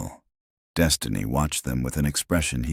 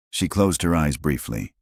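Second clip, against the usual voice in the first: ordinary delivery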